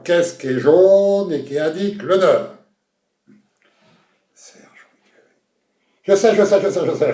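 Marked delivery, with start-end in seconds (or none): 6.0-7.1 excited buzz-in, tumbling repeats; eager contestant. Acted. Contestant jumping in to answer the host, excited. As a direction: blurt it out high and loud, falling away.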